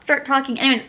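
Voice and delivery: ordinary delivery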